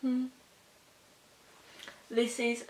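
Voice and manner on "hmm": ordinary talk, nothing marked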